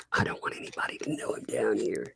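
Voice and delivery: soft secretive whisper. Retold or imitated. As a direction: whisper it like a sneaking child. Little girl sneaking, retold.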